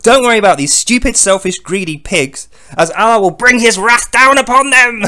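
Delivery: mock raging decree; foaming rant. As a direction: rant it like a god losing his temper. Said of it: "foaming at the mouth".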